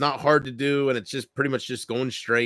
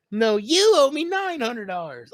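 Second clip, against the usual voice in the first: higher, lightly voiced Bart comeback; Bart retort; 10 semitones higher than usual. Bart arguing with the Australian kid, light kid voice. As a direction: throw it back like a bratty kid.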